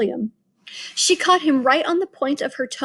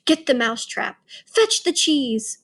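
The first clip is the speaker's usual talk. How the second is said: higher, eager witch chatter; excited witches; 6 semitones higher than usual. A narrator's reading. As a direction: bustle about eagerly.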